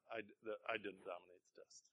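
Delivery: usual speaking voice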